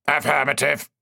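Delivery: a touch of a machine voice; lightly robotic tech priest. A narrator's reading. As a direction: answer like a cold machine servant.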